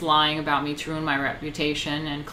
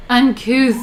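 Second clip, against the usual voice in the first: loud, higher haughty sniff of a word; mock aristocrat; 6 semitones higher, 9 dB louder than usual. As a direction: spit out Uncouth like a scandalised noble.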